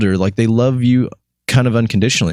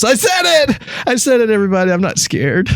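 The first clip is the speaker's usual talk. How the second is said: high-pitched mock-defiant outburst, easing down; mock defiance; 12 semitones higher than usual. Host jokes to everybody about his friend, mock defiant. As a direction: playfully own the insult, voice jumping high.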